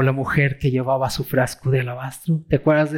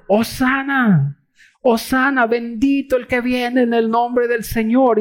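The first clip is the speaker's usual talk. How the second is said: crowd's hosanna cry; 9 semitones higher than usual. Retold or imitated. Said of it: "gritaban, ¿qué?". (grito de hosana, agudo y lento)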